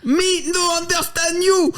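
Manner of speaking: high, slow mock Chinese English; Chinese-accented English. Police chief pressing the captive, slow Chinese-accented English. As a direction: hold each word high in a put-on Chinese accent. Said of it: "un accent chinois en anglais".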